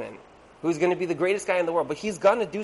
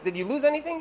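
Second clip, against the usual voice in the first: wife asking; 9 semitones higher than usual. Retold or imitated. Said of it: "his wife's like".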